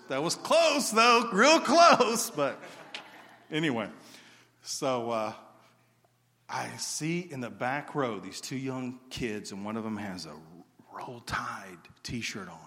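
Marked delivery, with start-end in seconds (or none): 0.1-2.3 high, loud jokey aside to the crowd; mock rivalry boast. Preacher teasing Georgia fans in the crowd, loud mock boast. 10.9-12.6 slow, soft whisper; hushed aside. Acted. Preacher letting the crowd in on the kid's shirt, slow whisper.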